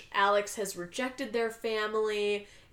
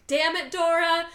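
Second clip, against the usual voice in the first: loud, raised-pitch exasperation; hammed-up frustrated curse; mock angry; 7 semitones higher, 6 dB louder than usual. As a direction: imitate an angry scolding outburst. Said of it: "And gets angry".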